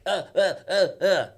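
loud, high, mock dumbstruck "uh"s; repeated tongue-tied grunts, pushed loud; starstruck stammering grunts. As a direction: imitate someone too starstruck to form words. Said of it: "you would just be like".

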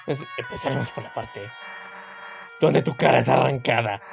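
lightly voiced tough girl threatening.

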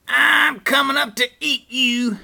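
high, loud threatening scream; troll's scream. A narrator's reading. Troll threatens Great Big Billy, screaming. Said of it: "screamed the troll".

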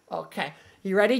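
ordinary talk, nothing marked

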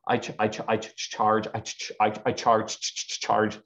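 stuttering and stalling before the number; a young speaker fumbles out his first fee, stammering; mock nervous stammer. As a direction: trip over the word charge like a nervous kid. Nervous naming of big price.

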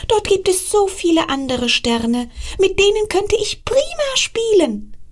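put-on high, bright child voice asking mum; a small star pleading with his mother; little star child. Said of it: "fragte er seine Mutter".

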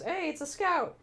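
John spotting a cosplayer, calling out delighted; excited greeting. Retold or imitated. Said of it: "John turns to me and goes".

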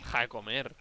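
quiet aside. Real, not acted.

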